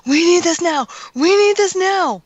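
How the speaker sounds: high, loud mock-frantic imitation of husband; excited demand. Wife imitating husband urgent about a comic, high excited shouting.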